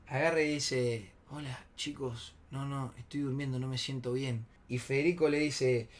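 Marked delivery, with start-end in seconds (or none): 1.3-4.4 low-energy fake sleepy voice; feigned sleepiness. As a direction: pretend you were just woken up. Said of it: "se hace el dormido".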